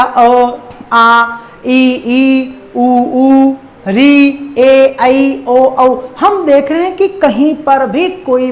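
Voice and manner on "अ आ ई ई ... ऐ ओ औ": each Hindi vowel अ आ ई उ ए ऐ ओ औ said slowly and separately, mouth wide open; teacher sounding out each vowel for the class; vowels demonstrated. Shown as a demonstration. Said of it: "पूरे ग्यारह स्वरों का हमने उच्चारण किया".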